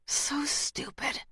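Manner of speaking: scornful hissed aside; contemptuous whisper. A narrator's reading. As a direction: sneer it under breath. Rains scoffs in a whisper.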